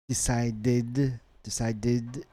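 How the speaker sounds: "decided" ending in a clear did. Shown as a demonstration. Teacher demonstrating the ED sound, id ending on "decided". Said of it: "id, E D sound here".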